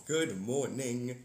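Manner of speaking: ordinary talk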